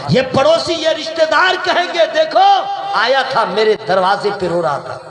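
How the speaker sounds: high, forceful sermon delivery; fervent preaching. Real, not acted. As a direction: preach it out to the whole crowd.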